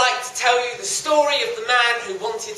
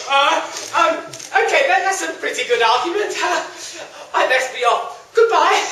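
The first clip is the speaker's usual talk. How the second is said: nervous high voice; 7 semitones higher, 6 dB louder than usual. Acted. Young man frightened by monster.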